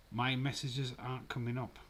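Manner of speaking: ordinary talk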